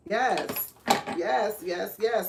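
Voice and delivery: normal talking voice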